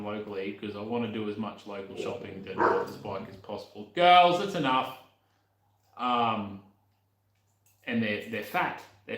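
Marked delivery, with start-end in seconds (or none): 3.9-5.0 loud, higher, drawn-out telling-off; calling out sternly. Real, not acted. Owner telling the girls that's enough, calling out loudly. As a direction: call them to order, loud and slow. Scolding noisy dogs off to the side.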